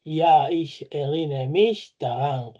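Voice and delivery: slow, careful German example; deliberate teacher pace; slow model sentence. Shown as a demonstration. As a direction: read it slowly for learners. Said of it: "Sich erinnern".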